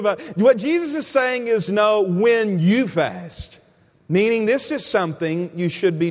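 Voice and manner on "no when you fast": slow, stretched "when"; stress on WHEN. Real, not acted. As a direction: correct them with a long "when". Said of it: "highlight that word when".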